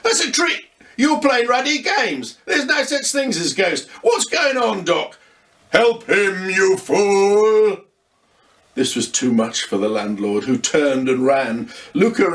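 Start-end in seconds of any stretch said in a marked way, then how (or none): 0.0-5.2 higher, mild mock fear from the landlord; a touch of panic in a gruff landlord; terrified landlord. A narrator's reading. Landlord panics at the ghost, higher mildly frightened voice. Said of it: "John, however, was terrified". 5.7-7.8 louder, commanding ghost king; angry ghost king. A narrator's reading. The ghost orders the landlord to help, louder commanding ghost.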